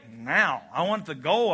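normal talking voice

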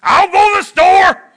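high, loud snap on go; angry shout. Shown as a demonstration. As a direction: spit it out in irritation. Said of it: "there's a difference between saying".

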